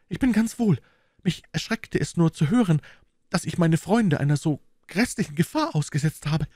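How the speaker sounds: höhere, sanfte Frauenstimme der Mary; leicht gesprochene junge Frau. A narrator's reading. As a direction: als Mary, leise erschrocken, höher. Mary voiced by reader. (Mary beruhigt die Freunde, höhere Frauenstimme)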